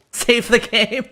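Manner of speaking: high, slow laughing plea. Real, not acted. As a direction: laugh while pleading. Laughing panic after the run goes bad.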